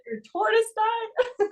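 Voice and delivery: high, slow, drawn-out surprise; surprised question. Retold or imitated. Coach to crying gymnast, high slow surprise. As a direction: stretch it out, high and disbelieving. Coach hears the tortoise died.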